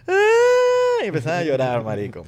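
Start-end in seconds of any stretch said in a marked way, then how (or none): 0.1-1.0 put-on very high, loud toddler wail; child's wail. Toddler bursting into tears after parents panic, loud high wail. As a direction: start bawling like a startled toddler.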